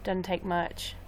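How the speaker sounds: easy, understated Southern accent; slow it down into a light Southern accent; relaxed hint of a Southern twang. Shown as a demonstration. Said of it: "slow it down and talk like this".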